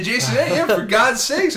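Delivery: high, exasperated disbelief at the name; exasperated groan. Real, not acted. Fan moaning about the writer, exasperated and high. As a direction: roll your eyes and whine it out.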